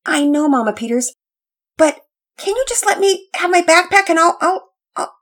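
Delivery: high, stammering plea; flustered boy trying to stall; nervous pleading. Acted. Boy begs to keep backpack.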